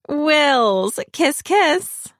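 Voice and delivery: high, loud air-kiss greeting; gushing socialite. A narrator's reading. Parker greeting William with an air kiss, high and gushing.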